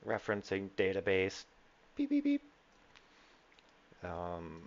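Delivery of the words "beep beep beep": high electronic beeping; computer beeps. Acted. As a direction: beep like a ship computer. GM voices ship computer.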